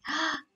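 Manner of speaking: quick surprised gasp. Real, not acted. Streamer spots the restaurant, surprised gasp. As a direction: suck in a breath.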